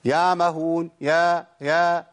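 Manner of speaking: "يعمهون" as "yaamahoon", no ع; dropped ع. Shown as a demonstration. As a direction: isolate the faulty "يا", a long open vowel. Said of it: "أنا كده ما جبتش عين خالص".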